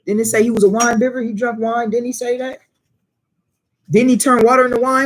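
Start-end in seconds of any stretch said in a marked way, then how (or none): none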